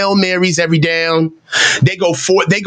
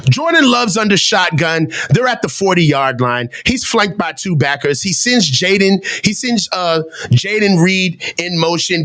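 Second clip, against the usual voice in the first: play-by-play; 2 semitones lower than usual. Acted. Plays own game with play-by-play.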